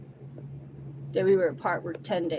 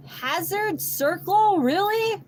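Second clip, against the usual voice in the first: high, drawn-out disbelief; incredulous; 12 semitones higher, 5 dB louder, much slower than usual. Retold or imitated. Reacting to the address he sends, incredulous. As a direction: squeal the place name in disbelief.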